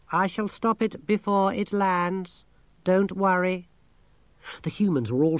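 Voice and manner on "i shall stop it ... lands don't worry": high, slow monotone machine voice; talking machine, matter-of-fact. A narrator's reading. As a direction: explain it evenly like a computer.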